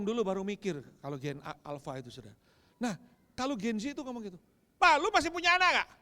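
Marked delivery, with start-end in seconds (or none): 4.7-5.9 high, loud accusing cry at dad; louder angry shout. Retold or imitated. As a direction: hurl it at him even harder. Daughter's question retold again. (teriakan marah yang lebih keras)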